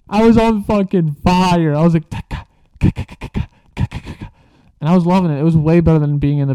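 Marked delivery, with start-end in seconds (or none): 2.1-4.3 camera shutter noise. Retold or imitated.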